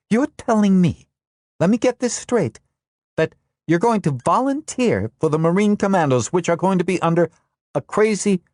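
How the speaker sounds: confused, angry demand; still angry. Acted. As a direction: press him angrily for the truth. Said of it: "and still angry".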